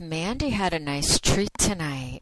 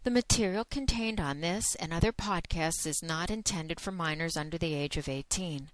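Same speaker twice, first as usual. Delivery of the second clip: brisk, even legal-warning read; disclaimer read; 4 dB quieter than usual. Acted. Host reading the warning to listeners, matter-of-fact. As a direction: read the terms like a recorded notice. Reads the adult content disclaimer.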